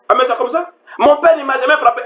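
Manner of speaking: high-pitched acted protest; indignant wife. Wife protesting to the husband who hit her, mock indignant.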